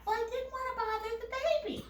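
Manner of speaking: high, rapid mock excuse; quick high-pitched mimicry; whiny mother imitation. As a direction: imitate a mother justifying herself.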